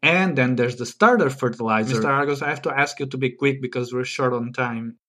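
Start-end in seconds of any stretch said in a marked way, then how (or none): none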